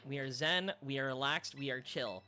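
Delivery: soft, low and calm; zen calm. Real, not acted. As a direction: settle the voice down. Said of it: "We gotta stay calm, chat".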